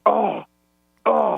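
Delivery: drawn-out "oh" of dismay; mock groan. Caller imitating his week watching football, mock groaning. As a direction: groan like someone watching his team lose. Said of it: "your voices all week watching football".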